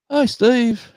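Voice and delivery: very high, bright greeting; friendly locals. Retold or imitated. Shop staff greeting a regular, very high and cheery. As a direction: sing out a cheery hello. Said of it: "those same people go".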